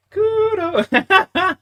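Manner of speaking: Kudo squeaked very high; mocking himself in falsetto; squeaky mock voice. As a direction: squeak the word Kudo like a cartoon. Mocks the name in high voice.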